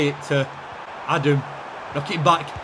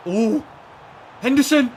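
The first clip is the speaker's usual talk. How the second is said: urgent call; 10 semitones higher, 6 dB louder than usual. Real, not acted. Chance falls to Henderson.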